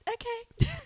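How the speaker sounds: squeaky high, drawn-out okay; a silly high drunk okay; high dopey okay. Retold or imitated. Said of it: "And I was like".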